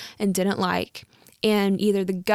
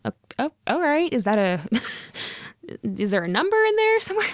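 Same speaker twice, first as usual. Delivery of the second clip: squeaky disbelief at a silly offer; mock surprise; 4 semitones higher, faster than usual. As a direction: pitch jumps much higher, fast and incredulous. Imagined haggling, mock surprised reply.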